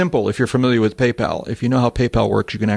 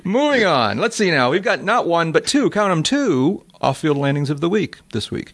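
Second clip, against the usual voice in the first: high lively intro; upbeat announcement; 6 semitones higher than usual. Real, not acted. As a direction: announce the next segment brightly.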